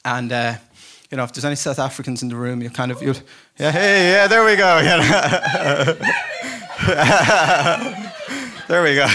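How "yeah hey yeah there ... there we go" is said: much louder, higher, laughing; laughing call-out. Real, not acted. Preacher greeting cheering South Africans, loud laughing call-out. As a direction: laugh and call back to the crowd.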